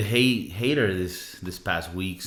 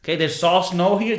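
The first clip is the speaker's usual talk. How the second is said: usual speaking voice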